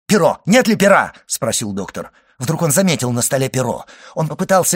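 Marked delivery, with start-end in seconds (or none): 0.1-1.1 raised, loud urgent demand; urgent call. A narrator's reading. Doctor calls for a feather during the fit, urgently. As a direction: call out for it in a hurry.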